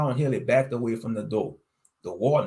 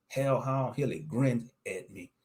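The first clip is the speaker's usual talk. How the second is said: ordinary delivery, nothing marked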